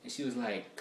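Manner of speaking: ordinary talk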